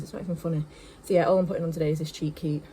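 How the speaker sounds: normal talking voice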